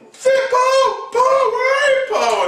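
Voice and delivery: very high squealed cry; mock frantic calling. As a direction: call out desperately in a high mock panic. Acts Finn calling for lost Poe.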